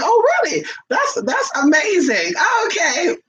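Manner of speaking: high hammed-up surprise; mock surprised girlfriend. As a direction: gush it out in a high, amazed voice. Girlfriend's surprised reaction retold.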